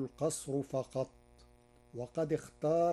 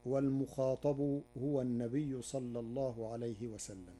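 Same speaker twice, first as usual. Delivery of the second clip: ordinary talk, nothing marked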